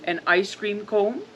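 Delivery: plain reading voice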